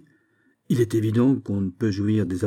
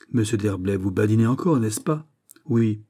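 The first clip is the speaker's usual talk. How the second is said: usual speaking voice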